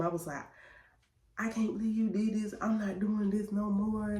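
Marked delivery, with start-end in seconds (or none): 1.4-4.2 mildly mad, higher-pitched imitation of herself; retold anger. Wife in labour telling off her husband, mock mad. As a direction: act out a raised-pitch complaint, only mildly angry. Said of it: "I was so mad at him".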